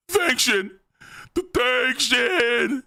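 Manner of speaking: high, put-on wailing voice; hammed-up emotional thanks. New father thanking Shin, high overwrought cry. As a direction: squeal the thanks, mock overcome.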